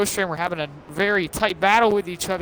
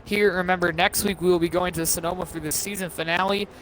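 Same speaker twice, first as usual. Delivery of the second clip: usual speaking voice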